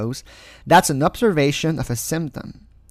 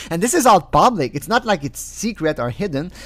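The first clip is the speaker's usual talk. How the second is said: usual speaking voice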